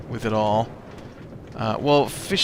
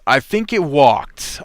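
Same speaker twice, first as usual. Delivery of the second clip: slow, louder deadpan sarcasm; sarcastic reply; 4 semitones higher, 8 dB louder, slower than usual. Retold or imitated. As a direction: drag it out like a sarcastic joke. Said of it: "my reply was".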